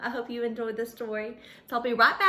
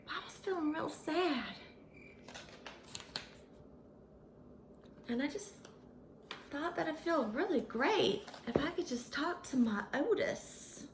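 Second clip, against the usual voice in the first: soft lonely; 8 dB quieter than usual. Acted.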